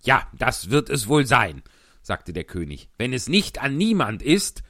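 loud, high blustering king; pompous king. Acted.

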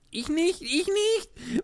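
very high, mildly sobbing denial; sobbing Pinocchio. Acted. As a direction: cry out your innocence high and tearful. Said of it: "rief Pinocchio schluchzend".

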